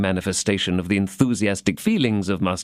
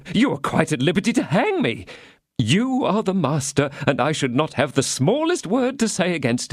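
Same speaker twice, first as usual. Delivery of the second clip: higher, pleading little mercer voice; fawning mercer; 8 semitones higher than usual. A narrator's reading. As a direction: grovel to the cardinal in a thin voice. Bonacieux voiced.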